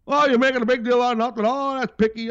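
high, fast mocking voice of a critic; mocking whiny critic. Mocking the critics who call him picky, high and fast. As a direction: sneer out the dismissive complaint.

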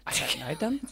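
soft unvoiced whisper. Imitating the daughter whispering about the strangers. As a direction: breathe it like a secret.

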